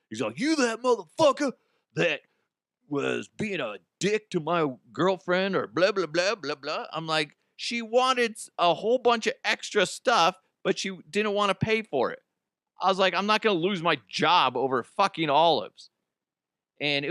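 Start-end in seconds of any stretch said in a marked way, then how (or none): 0.4-6.9 mock angry rant, pitched up; imitating pissed boyfriend. Boyfriend confronting clerk about his girlfriend, mock irate rant. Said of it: "he is just pissed". 7.6-15.9 high, exasperated self-defense; retold indignant protest. Clerk answering the angry boyfriend, high exasperated protest.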